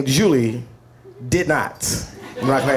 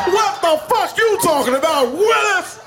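mock shout. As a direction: blast the Willis line way up high. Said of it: "I'm like".

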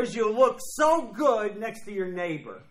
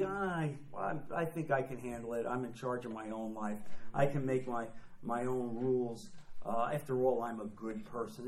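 smug self-reliance; 6 semitones lower, 6 dB quieter than usual. Retold or imitated. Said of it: "Satan likes nothing better than for you to say".

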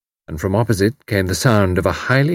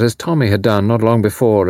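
plain reading voice

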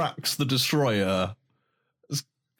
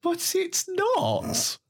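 high, drawn-out "But it's not"; high slow mock retort; much slower than usual.

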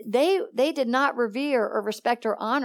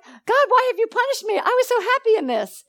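much higher, mock-complaining voice; whiny complaint to God; 10 semitones higher, 3 dB louder than usual. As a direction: play the hurt complainer. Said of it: "We feel like".

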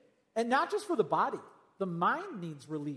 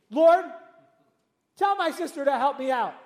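higher and louder, mock complaining holler; 5 semitones higher, 7 dB louder than usual. Martha complaining to Jesus, mock holler. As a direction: yell it like a fed-up sister. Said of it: "She hollered at Jesus".

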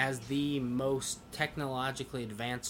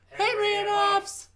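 very high, slow, louder cartoon squeal; squeaky drawn-out voice; 7 dB louder, slower than usual. Outro gag, drawn-out very high squeal of the show name.

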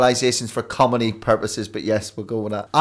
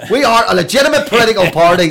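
much louder and higher, fast hard-edged Northern Irish delivery; menacing Northern Irish statement; 12 semitones higher, 12 dB louder, faster than usual. Retold or imitated. Mocking how people hear the Northern Irish accent, loud hard Northern Irish delivery. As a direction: rattle it off like a threatening spokesman. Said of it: "That's all people hear".

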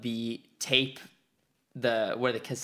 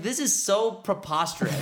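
a slightly higher, laughing fake disbelief; mock outrage; 7 semitones higher than usual. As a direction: scoff at the silly rumors. Mock outrage at fan rumors.

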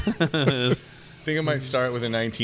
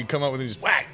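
ordinary delivery

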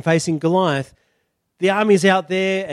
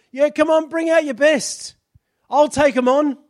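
higher and louder, taunting; giant's rant; 7 semitones higher, 5 dB louder than usual. Acted. Goliath ranting at the Israelites, higher and louder. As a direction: jeer at the army.

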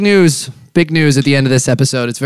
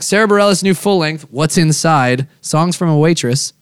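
normal talking voice

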